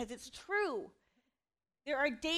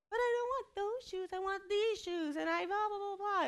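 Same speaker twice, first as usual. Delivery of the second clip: much higher whiny kid voice, mocking; whiny child; 8 semitones higher than usual. Mom mimicking her son's shoe complaint in a high whine. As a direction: imitate a sulky child refusing shoes.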